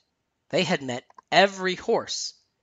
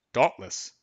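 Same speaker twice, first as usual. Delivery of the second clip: clearing throat first. A narrator's reading. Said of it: "The groom cleared his voice".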